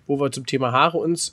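usual speaking voice

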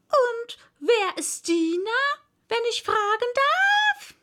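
loud, high, drawn-out parrot; high-pitched squawky question with a long held ending. A narrator's reading. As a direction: ask showily in a high parrot voice. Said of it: "sagte der Papagei".

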